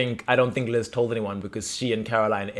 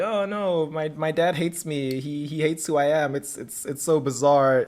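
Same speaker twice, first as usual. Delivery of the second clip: mock distress; 3 semitones higher than usual. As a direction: lift the pitch and lament it gently. Daughter upset about dad, acted.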